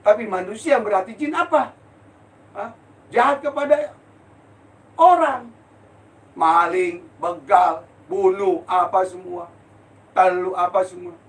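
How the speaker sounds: high, loud sermon climax; fiery preaching. Acted. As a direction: preach it with rising fury. Preaching at full force.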